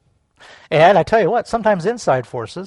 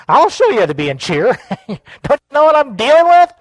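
rising to a high, loud retort; hammed-up angry comeback; mock outrage; 7 semitones higher, 6 dB louder than usual. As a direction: blow up in comic indignation. Acting out annoyed reply to pastor.

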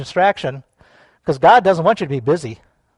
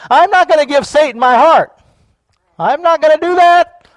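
high, loud preacher's shout; emphatic, rising cry of resolve; defiant declaration; 10 semitones higher, 8 dB louder than usual. Shown as a demonstration. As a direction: proclaim the refusal at full force. Declaring refusal to congregation.

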